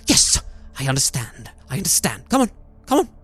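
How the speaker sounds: a mad hermit in sudden revelation; manic old man. Acted. The haggard man welcoming Gabe inside, excited. Man understands Gabe's arrival.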